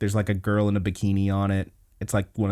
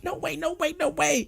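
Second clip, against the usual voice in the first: high, softer mock panic; freaking out; 5 dB quieter than usual. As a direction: panicky refusal, pitched way up. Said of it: "like, freaking out".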